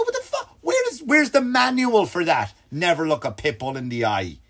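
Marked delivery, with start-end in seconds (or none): 0.0-2.5 high-pitched comic outrage; incredulous. Retold or imitated. Visitor answers Corey's pit bull rule, incredulous. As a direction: squeal it up in exasperated disbelief. Retold incredulous comeback to friend.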